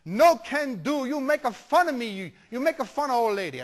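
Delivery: indignant Italian grandma, high and loud; Italian grandmother accent. Retold or imitated. Grandmother thinks grandson is mocking her, offended Italian accent. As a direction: protest loudly in broken, Italian-accented English. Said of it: "nice little old Italian lady".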